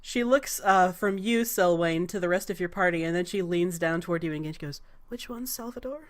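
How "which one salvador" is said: soft, intimate regal query; hushed question. Acted. Goddess leaning down to a petitioner, hushed. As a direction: lean in and ask it quietly.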